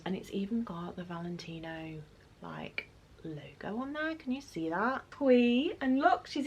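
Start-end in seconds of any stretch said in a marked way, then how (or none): 0.0-4.1 hushed whisper over the logo; awed little whisper. Real, not acted. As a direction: breathe it out in a whisper.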